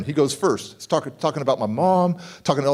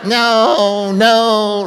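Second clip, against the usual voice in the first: laughing no; 4 semitones higher, 6 dB louder than usual. Real, not acted. Laughing correction to audience.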